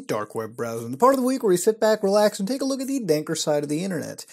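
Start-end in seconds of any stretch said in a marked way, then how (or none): 0.0-0.9 low, soft, menacing announcer; ominous title. Pretend. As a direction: drop into a dark movie-trailer voice. Jokey swap of show title.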